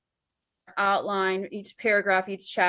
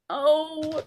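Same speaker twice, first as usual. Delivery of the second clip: high, drawn-out mock anguish over a scene; mock distress; 8 semitones higher, 3 dB louder, much slower than usual. Writer to husband on the couch, high hammed-up distress. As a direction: wail it like the story is breaking her heart.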